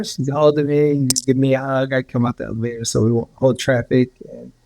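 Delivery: usual speaking voice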